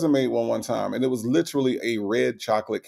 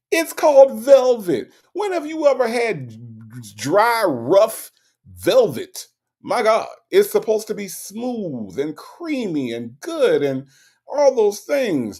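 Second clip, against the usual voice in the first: indignant; 10 semitones higher, 3 dB louder, slower than usual. Real, not acted. Indignant rant about dry cake.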